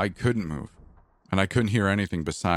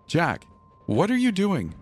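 alarmed wife; 12 semitones higher than usual. Acted. Wife sees husband at crib.

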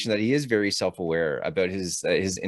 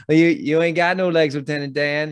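Forrest voice; 7 semitones higher, 4 dB louder than usual. Retold or imitated. Forrest blurts to Lieutenant Dan, higher and louder. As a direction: do Forrest, a bit pushed up. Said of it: "Forrest Gump's like".

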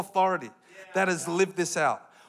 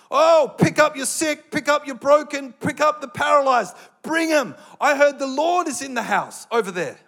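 calling out eagerly, raised and loud; excited crowd voice; 6 semitones higher, 7 dB louder than usual. Retold or imitated. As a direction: urge everyone to bring the sick.

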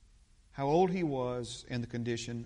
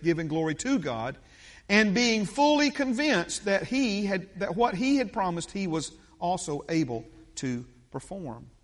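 loud, emphatic Bible reading; preaching scripture; 4 semitones higher, 7 dB louder than usual. Acted. Preacher reading Abraham's story to the congregation, loud and emphatic. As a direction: read the verse out with forceful conviction.

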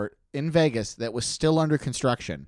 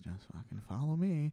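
urgent whisper; 2 semitones higher, 11 dB quieter than usual. Retold or imitated.

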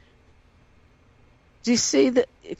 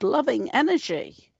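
normal talking voice

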